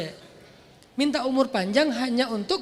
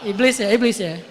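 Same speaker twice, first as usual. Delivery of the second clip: laughing; 9 dB louder than usual. Real, not acted.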